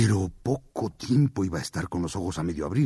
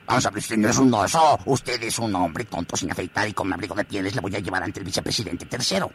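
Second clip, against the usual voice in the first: enfadado; faster than usual. Acted. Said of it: "muy enfadado".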